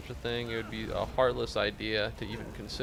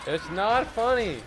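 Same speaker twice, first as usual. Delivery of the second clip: higher, louder, slow indignant protest; mock outrage; 7 semitones higher, 5 dB louder, slower than usual. Streamer scolding chat for laughing, mock indignant. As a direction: pretend to be offended.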